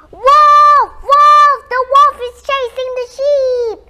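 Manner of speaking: high, very loud yelling; full-voiced cry of alarm; shouted false alarm. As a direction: scream for the villagers to come. Boy raises second false alarm.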